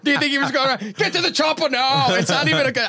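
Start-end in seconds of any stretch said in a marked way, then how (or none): 1.0-2.1 a light, higher hint of Arnold; mock action-hero order, lightly voiced; Arnold impression. Host mocking the governor with a light Arnold voice. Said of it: "I'm bad at impressions".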